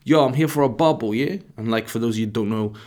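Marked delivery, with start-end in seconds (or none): 0.0-1.4 mock London grime accent, louder; London grime slang. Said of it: "as they say in the grime scene in London".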